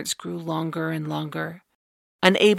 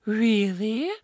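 gasping surprise; 4 semitones higher than usual. A narrator's reading. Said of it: "The other gasped".

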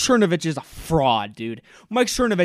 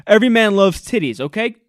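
hammed-up dudebro voice, louder; mock Clay Travis; 2 semitones higher, 8 dB louder than usual. Mocking Clay Travis's inner voice, loud dudebro. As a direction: play the macho troll figuring out his stunt. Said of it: "he's like".

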